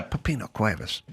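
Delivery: softly, almost under breath: "Pepino Cuevas"; quiet reverent name; hushed name. Retold or imitated. Retelling saying the name.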